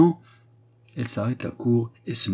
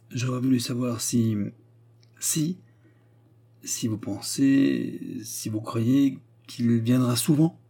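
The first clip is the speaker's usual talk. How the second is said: hesitant stammer; slower than usual. Acted. Anna hesitates over her question.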